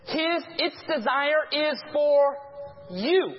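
stretched out, leaning on for; slow warning from the pulpit; slow emphatic reading. Shown as a demonstration. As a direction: draw the verse out slowly. Preacher slowly reads scripture.

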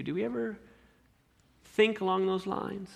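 usual speaking voice

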